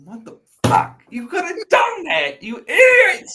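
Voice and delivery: high, loud exasperated shout; mock furious yell. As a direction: yell at the screen in frustration. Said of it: "I'm like".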